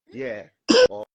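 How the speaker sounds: ordinary talk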